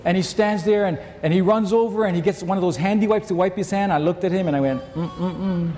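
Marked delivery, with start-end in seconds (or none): 4.9-5.7 disapproving hum. Retold or imitated. Father disapproving at son.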